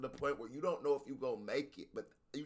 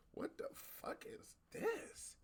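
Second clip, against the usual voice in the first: mock bafflement; 9 dB quieter, much slower than usual. Homeboy's baffled reaction to Ren.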